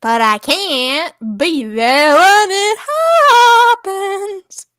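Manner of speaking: put-on slow, wailing pseudo-Italian accent; hammed-up fake accent. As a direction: drag the vowels out in a fake accent. Same hammed-up [name] reading.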